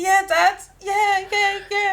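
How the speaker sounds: put-on high, loud kid pleading with dad; eager child. Child assuring dad at the shop, eager. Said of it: "I say".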